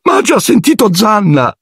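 very high squeaking terror; frightened falsetto. A narrator's reading. Ron protesting to Harry about the dog, high frightened falsetto. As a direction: voice cracking high with fear. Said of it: "con la voce in falsetto dalla paura".

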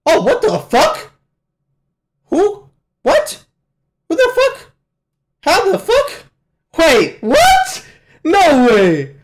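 very high, squeaky bursts of astonishment; shocked disbelief. Real, not acted. Viewer stunned by a twist in the episode, shocked disbelief. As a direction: blurt each question in a high yelp, ending loud.

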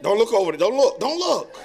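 loud, hammed-up don't-look warning with a laugh; mock urgent warning. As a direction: play a neighbour on the deck warning the others. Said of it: "and you're like".

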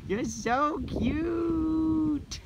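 high, very drawn-out coo; melting, slow high squeal; adoring. Real, not acted. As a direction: coo at a cute animal, stretching it right out. Gushing over a red panda.

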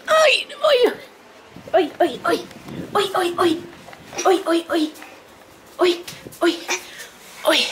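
high repeated siren whoops; imitated siren. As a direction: mimic a siren in short high bursts.

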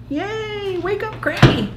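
high-pitched playful wake-up call; playful cheer. Pretend. Reader rouses Crabby after the book, playful high cheer.

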